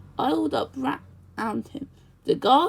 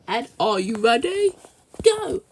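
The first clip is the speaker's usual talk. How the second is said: race starter call; 5 dB louder than usual. A narrator's reading. Station master starts race.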